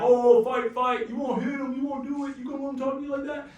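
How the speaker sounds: high, loud taunting jeers; mock crowd egging on. Crowd taunting a man to fight, jeering. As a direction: egg them on to fight, mockingly.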